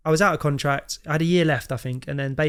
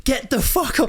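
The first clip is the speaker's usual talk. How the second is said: retold furious yell, much higher; angry yell; 9 semitones higher, slower than usual. Telling his mum to leave the pitch, imitated angry yell. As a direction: snap it at her like an embarrassed teenager. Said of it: "And I was like".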